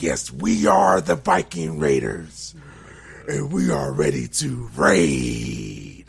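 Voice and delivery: low, drawn-out, loud raspy promo voice; raspy wrestler. Retold or imitated. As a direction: growl it slowly like a Viking wrestler. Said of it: "he's talking with that graspy voice".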